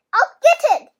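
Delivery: loud eager yell from another room. A narrator's reading. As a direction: call out loudly, eager to help. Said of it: "Max yelled".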